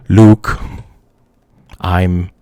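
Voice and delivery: half-hearted low villain, dropping away; Darth Vader. Acted. Streamer imagining a flipped movie script, light villain impression. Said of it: "what if he flipped the fucking script".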